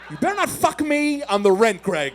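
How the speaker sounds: hammed-up outraged warning; mock angry warning. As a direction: act out a partner telling him off. Acted partner's angry warning to comedian.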